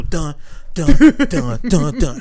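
Mission Impossible theme hummed, dun by dun; humming spy theme. Pretend. Humming the Mission Impossible theme while stepping in. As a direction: hum the spy movie theme. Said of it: "play Mission Impossible".

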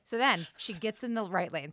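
ordinary delivery

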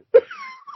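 high-pitched laugh; helpless laughter. Real, not acted. Podcaster cracking up at the plot, helpless high laughter. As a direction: burst out in high, squeaky laughter.

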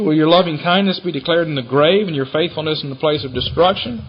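ordinary talk, nothing marked

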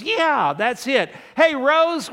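high, loud burst of recognition; excited calling out. Acted. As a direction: play a sudden eureka, then holler across the house. Joke: man remembers, calls wife.

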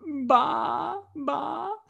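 imitating a sobbing little boy's sheep noise; mock tearful bleat. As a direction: bleat weakly like a sheep through pretend tears. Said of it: "he's kinda crying".

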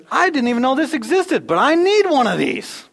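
high, loud, eager gush; excited buyer. Acted. A rich man on a plane spotting a sale, gushing. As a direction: gush over a gadget you must have.

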